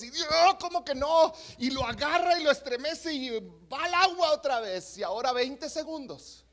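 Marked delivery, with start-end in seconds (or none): 0.5-1.3 higher-pitched, zealous mock outcry; shouty preacher. Pastor who won't accept the drunk's no, mock shouting. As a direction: play an over-zealous pastor's indignant cry. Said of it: "así como somos gritones".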